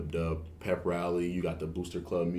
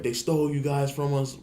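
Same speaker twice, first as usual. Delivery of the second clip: higher, jeering rival-fan taunt; trash-talking taunt; 7 semitones higher, 3 dB louder than usual. Retold or imitated. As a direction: throw it out like a heckling fan. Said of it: "they were talking trash".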